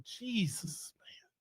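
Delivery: hushed, breathless "Jesus, man"; whispered dismay. Real, not acted. Reacting to a news report, real whisper. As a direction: breathe it out in disbelief.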